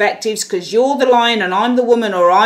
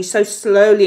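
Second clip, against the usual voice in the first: ordinary talk, nothing marked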